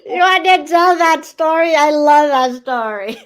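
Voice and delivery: loud, high, excited interjection; delighted outburst. Real, not acted. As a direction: burst in eagerly, loving the memory. Delighted at a shared funny memory.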